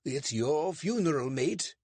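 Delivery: David's put-on British accent, pitched high. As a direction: fake a polite British accent to fool a constable.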